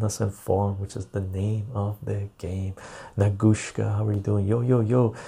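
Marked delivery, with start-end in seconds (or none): none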